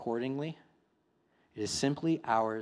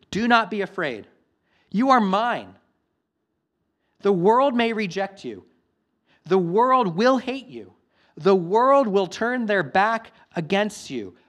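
loud, high, impassioned preaching; a pastor urging his church not to fear, loud and impassioned; preaching at full force; 7 semitones higher, 9 dB louder than usual. Acted. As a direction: proclaim it to the whole congregation. Climax of the sermon.

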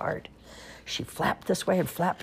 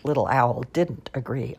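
plain reading voice

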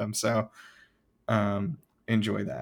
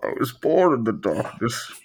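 muffled villain, slowing on molded; gas mask voice; 3 semitones higher, 7 dB louder, slower than usual. Pretend. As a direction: speak through a gas mask. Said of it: "the gas mask kind of voice".

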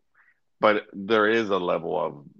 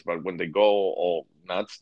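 ordinary talk

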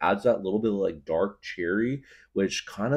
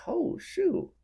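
shocked squeak; 4 dB quieter than usual. Retold or imitated. Shocked again.

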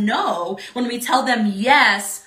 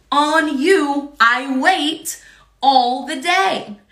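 slow emphasis; 2 semitones higher, 3 dB louder, much slower than usual. Shown as a demonstration. Repeats verse very slowly.

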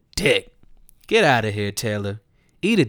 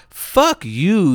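ordinary talk

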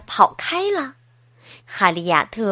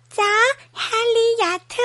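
a much higher, small mole voice; little mole voice; 9 semitones higher, faster than usual. Acted. 小鼹鼠回应哈利亚特，尖细小声. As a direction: squeak it out like a tiny mole.

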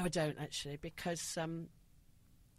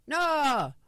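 put-on louder, higher Greek accent; 3 semitones higher, 5 dB louder than usual. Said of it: "Is that your Greek accent?".

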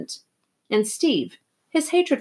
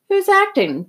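Two-Bit voice; 12 semitones higher, 5 dB louder than usual. A narrator's reading. Two-Bit joking quote.

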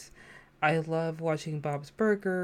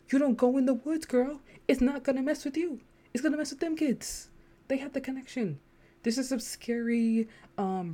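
higher-pitched, animated talking-to; sassy self-talk; 5 semitones higher than usual. Acted. Woman telling herself the Demogorgon is not real, sassy self-scolding. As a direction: set yourself straight with sassy disbelief. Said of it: "I had to tell myself".

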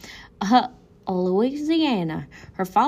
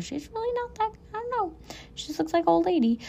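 mocking; 11 semitones higher, much faster than usual. Real, not acted. Mocking the photos.

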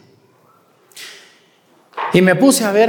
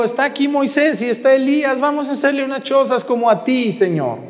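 excited Peter; 8 semitones higher than usual. Acted. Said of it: "Pedro estaba emocionado".

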